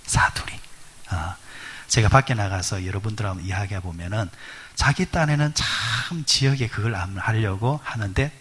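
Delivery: ordinary talk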